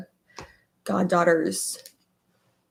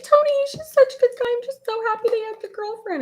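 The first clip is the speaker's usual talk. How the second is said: gushing excitement; faster than usual. Retold or imitated. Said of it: "And I was like".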